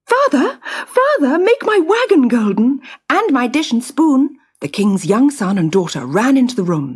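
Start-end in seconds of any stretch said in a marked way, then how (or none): 0.1-4.4 put-on much higher, childlike pleading call; child's voice. Children running to their father, high childlike call. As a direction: sound like a small eager child.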